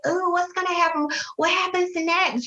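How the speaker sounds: put-on high-pitched, excited child voices; mock little-kid eagerness; imitating eager kids. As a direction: act out children hungry for the story. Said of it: "and they like".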